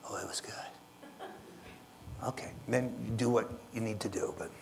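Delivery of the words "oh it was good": soft, slow whisper; whispered kid answer. Acted. Child whispering to parent how the cookie tasted. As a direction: breathe it out like a sheepish child.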